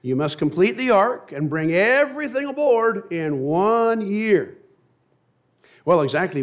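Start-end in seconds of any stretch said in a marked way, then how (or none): 0.0-4.6 higher, slower command from the Lord; lightly voiced Lord giving a stern reminder; God commanding. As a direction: lay down the deadline slowly. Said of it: "said the Lord".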